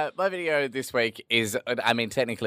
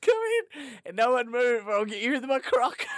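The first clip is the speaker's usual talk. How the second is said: high-pitched comic hold-up cry; mock hold-up; 14 semitones higher than usual. Co-host acting out the gator man's store hold-up, high mock threat. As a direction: squeal it like an absurd stick-up.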